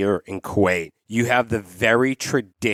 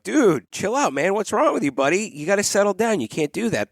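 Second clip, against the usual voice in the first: high, firm dad telling his son to settle down; a dad calmly but firmly checking his boy; firm but kind scolding; 8 semitones higher than usual. Retold or imitated. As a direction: rein the kid in without insulting him. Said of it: "There's the way of".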